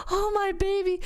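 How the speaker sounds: high, hammed-up tender cry; the movie-moment delight; mock tender gasp. Mom imagining the tender moment she missed, high. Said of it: "that moment of".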